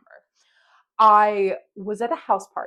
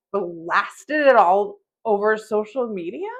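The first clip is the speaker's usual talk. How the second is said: usual speaking voice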